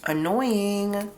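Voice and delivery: ordinary delivery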